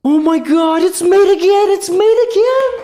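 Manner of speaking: loud high-pitched shock; screaming disbelief. Real, not acted. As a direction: escalate into gasping, hysterical screams. Screaming at repeated blunder.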